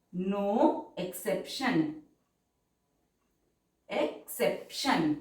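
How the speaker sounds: very slow, drawn-out dictation pace; stenography dictation, stretched syllables. Shown as a demonstration. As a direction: read it out word by word for shorthand.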